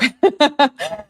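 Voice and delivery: loud high laugh bursting out; burst of laughter. Real, not acted. Host laughing at a viewer's comment, loud high laugh. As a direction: laugh out loud, caught off guard.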